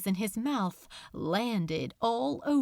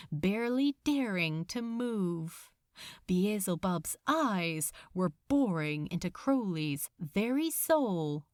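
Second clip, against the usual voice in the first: usual speaking voice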